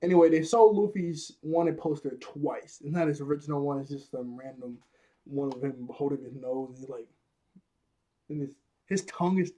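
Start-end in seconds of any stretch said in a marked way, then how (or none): none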